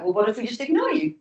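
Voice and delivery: ordinary delivery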